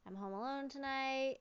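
ordinary talk, nothing marked

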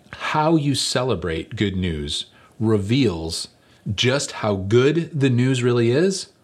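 slow preaching. Acted. Preaching the key point.

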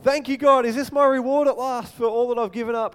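a man finally getting his reward, bursting with hope; eager Moses. Acted. Moses' thoughts voiced eagerly.

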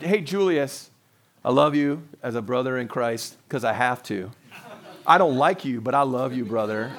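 low, reluctant declaration of brotherly love; grudging affection. Acted. Pastor mimics loving a brother because he has to, grudging and low.

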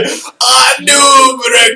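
very high, slow, shouted announcer call. Retold or imitated. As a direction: belt the name like an arena announcer. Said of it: "so I could hear him say".

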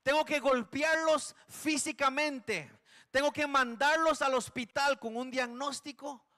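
God declaring punishment at full force. Acted. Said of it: "nos dijo el Señor".

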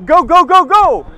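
loud high urging shout; yelling at a thief on the bike. Retold or imitated. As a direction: bellow go at him to scare him off.

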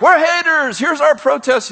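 high, hammed-up mock protester; mock hater. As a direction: send up the angry protester, pitched high. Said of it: "we don't wanna be like".

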